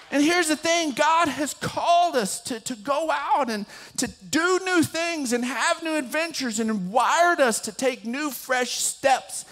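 high, energetic exhortation; impassioned preaching. Real, not acted. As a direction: urge the congregation on with conviction. Preaches application at full force.

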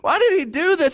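high-pitched mock bewilderment; bewildered onlookers. Pastor voicing stunned roadside people, high and incredulous. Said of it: "they're like".

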